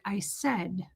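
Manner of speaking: slow whispered insult; soft slow taunt. Retold or imitated. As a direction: draw it out softly. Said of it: "she's like".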